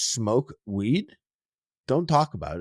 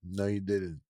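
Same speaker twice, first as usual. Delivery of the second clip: deadpan denial; 4 semitones lower, 10 dB quieter than usual. Shown as a demonstration. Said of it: "listen to me".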